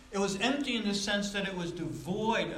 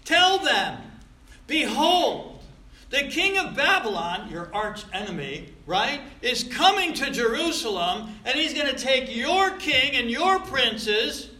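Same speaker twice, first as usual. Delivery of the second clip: forceful scripture reading; 6 semitones higher, 8 dB louder than usual. Acted. Preacher thundering God's message to the rebellious house. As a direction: proclaim it slow and loud, pressing each phrase.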